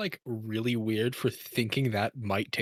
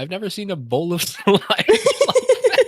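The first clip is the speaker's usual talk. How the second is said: talking through real laughter, higher; amused, pitched up with laughter; laughing; 9 semitones higher, 9 dB louder than usual. Real, not acted. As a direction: laugh your way through the words. Amused reaction to strange food.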